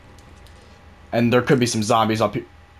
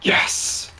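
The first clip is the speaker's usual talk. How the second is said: whispered cheer; 4 dB quieter than usual. Real, not acted. Quiet triumph after escape.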